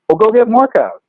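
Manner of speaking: high, brusque boss order; impatient boss. Retold or imitated.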